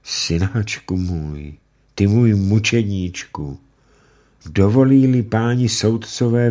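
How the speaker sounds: mother holding her son and pleading; grieving mother. Acted. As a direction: cradle him, then beg the judges. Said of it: "objímala syna a řekla".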